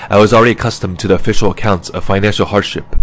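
ordinary delivery, nothing marked

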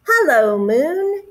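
loud call up toward the moon; shouting. A narrator's reading. Bear calls up to the moon from a tree, shouting. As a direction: call out as if to someone far off. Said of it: "he shouted".

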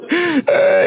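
long high mock sob; imitated crying wail. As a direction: howl like a man bursting into tears. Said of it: "he was crying".

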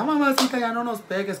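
put-on high begging kid voice; pleading child. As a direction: beg mom to stop hitting. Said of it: "Y ya".

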